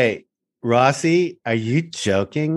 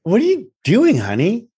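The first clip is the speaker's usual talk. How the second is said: mock high wife voice, lightly done; imitating wife; 8 semitones higher than usual. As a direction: echo the wife with a higher, lilting question. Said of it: "She goes".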